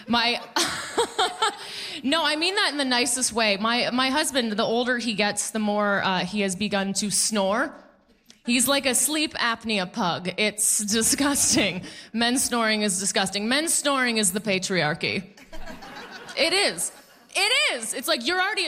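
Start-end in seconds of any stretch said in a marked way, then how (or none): 0.4-2.0 sudden high giggle; high laugh. Real, not acted. Laughs at own joke. 16.4-17.9 insistent. Real, not acted. Insisting loudly on a joke.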